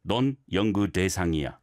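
quick, low, joined-up 넌 연구 대상이야; fast and even, no big stress on 대; one phrase. Shown as a demonstration. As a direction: run 연구 대상이야 on as a single phrase. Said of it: "think of it as one phrase".

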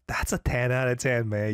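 half-laughing, half-crying praise; a touched host laughing out his verdict. Real, not acted. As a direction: overcome and laughing through the words. Host moved by the tribute video.

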